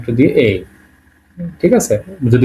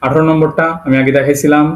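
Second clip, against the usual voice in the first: ordinary talk, nothing marked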